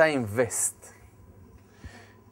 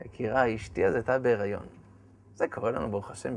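normal talking voice

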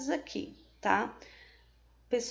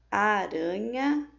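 aranha, joaninha stretched slowly, syllable by syllable; slow and drawn out; 3 dB louder, much slower than usual. Shown as a demonstration. Teacher reads the picture names to pupils, slow and drawn out. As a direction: draw each word out so the kids hear the syllables.

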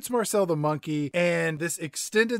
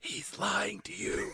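breathy stage whisper, much softer; mock whisper; 4 semitones higher, 11 dB quieter, slower than usual. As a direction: lean in and warn the audience in a whisper. Mock warning aside to listeners.

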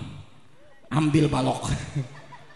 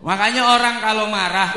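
normal talking voice

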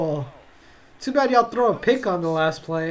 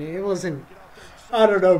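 ordinary talk

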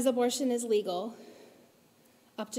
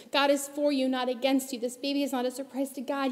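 ordinary delivery

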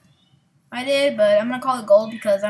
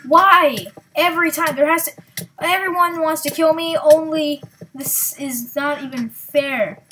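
loud and higher, fed-up yelling at the game; frustrated outburst; 4 semitones higher, 8 dB louder, slower than usual. Real, not acted. Gamer yelling at players who keep killing him, loud and fed up. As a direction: vent at everyone who keeps killing you. Keeps getting killed in game.

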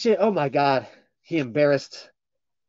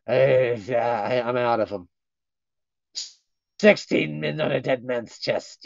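usual speaking voice

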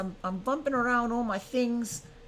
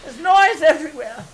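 high, louder dramatic cries; mock screaming; 5 semitones higher, 7 dB louder than usual. Drama king yelling about noise, mock screaming. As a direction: panic for show about the noise. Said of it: "Oh, I'm screaming".

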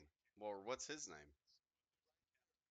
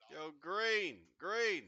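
calling out; 9 semitones higher, 12 dB louder, much slower than usual. Real, not acted. Calling out to teammate Green.

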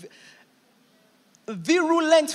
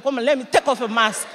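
louder, pleading call; fast then shouting, half joking; exasperated shout; 6 dB louder than usual. Retold or imitated. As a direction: demand to see behind the mask.

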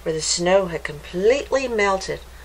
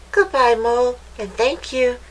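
higher, grateful goodbye; cheerful rabbit; 5 semitones higher, 4 dB louder than usual. A narrator's reading. Hopper thanking the mole, cheerful. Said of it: "said Hopper".

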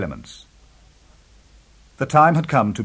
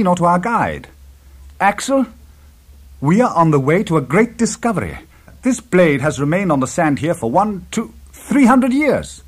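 raised, emphatic professor's exclamation; exclaiming; 6 semitones higher, 5 dB louder than usual. A narrator's reading. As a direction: proclaim a great discovery to your companions, pitched high. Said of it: "and exclaimed".

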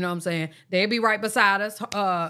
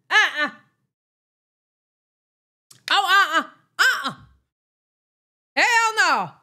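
high refusal; 8 semitones higher, 3 dB louder than usual. Retold or imitated.

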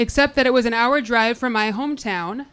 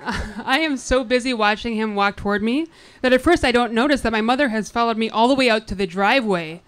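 usual speaking voice

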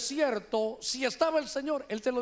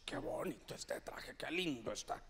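hushed, low murmur to oneself; admiring whisper; 10 semitones lower, 13 dB quieter than usual. Retold or imitated. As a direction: whisper it in wonder, savoring it privately. Admiring a gifted suit to himself.

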